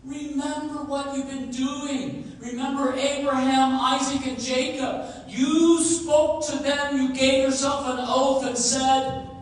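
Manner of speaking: loud, high, urgent pleading to God; impassioned plea. Acted. Preacher voices Moses begging God, loud and urgent. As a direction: beg God to turn from his anger.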